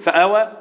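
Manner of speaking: فآوى said quickly with no long vowel; spoken فآوى without stretching the آ; shortened madd. Shown as a demonstration. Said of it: "ينفع أقول".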